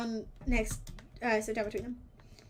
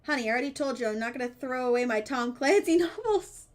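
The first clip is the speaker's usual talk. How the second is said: ordinary talk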